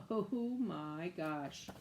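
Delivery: slow, drawn-out awe; slow amazed. Acted. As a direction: stretch it out in awe. Townsfolk gaping at the animals.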